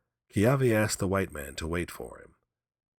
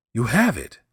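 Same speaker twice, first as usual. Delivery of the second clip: loud accusation; 6 semitones higher, 9 dB louder than usual. Acted. Kiave confronts the old man.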